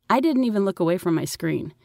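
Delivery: ordinary delivery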